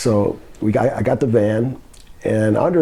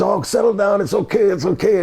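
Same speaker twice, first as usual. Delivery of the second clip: lightly acted reassurance, higher pitch; imitated settle-down talk, pitch raised; placating; 7 semitones higher than usual. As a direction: mildly mimic someone brushing off the fuss. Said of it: "He says".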